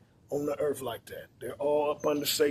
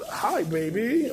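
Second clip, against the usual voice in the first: put-on slow, higher, sugary "Hi, baby."; mock sweet greeting; 5 semitones higher, much slower than usual. Man greeting his woman back from cheating, slow and sweet. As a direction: play the fool who greets her kindly.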